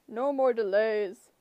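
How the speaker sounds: slow, loud and firm; monster voice. A narrator's reading. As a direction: lay down the law, brute. Cyclops voice.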